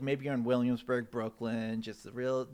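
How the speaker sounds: ordinary talk, nothing marked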